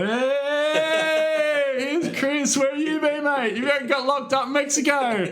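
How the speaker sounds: high-pitched rowdy hostel welcome, drawn-out opening hey; imitated cheering. Hostel crowd greets the returning traveller, cheering. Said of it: "everyone just started cheering, going".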